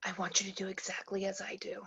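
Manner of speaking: urgent secret whisper. Acted. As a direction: whisper so the camera can't hear. Lights off, camera watching them.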